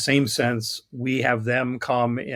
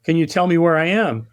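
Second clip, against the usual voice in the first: higher, louder mock call to someone below; 4 semitones higher, 5 dB louder than usual. Balloonist calling down to the man on the road, calling out. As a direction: hail a stranger from afar, politely. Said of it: "he calls out to the man".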